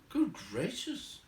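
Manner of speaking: high, drawn-out shock; startled hobbit. Acted. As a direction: Bilbo taken aback. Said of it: "he thought".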